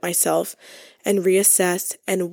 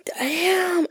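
awed damn; 10 semitones higher than usual. Real, not acted. Awed reaction to tattoo.

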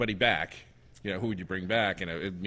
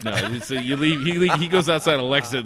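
ordinary delivery

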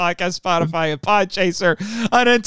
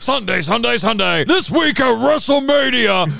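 wrestling announcer; 3 semitones higher than usual. Pretend.

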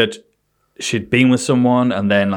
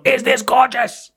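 very high, drawn-out; hammed-up needy woman; high slow demand; 13 semitones higher, slower than usual. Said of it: "and then she's going".

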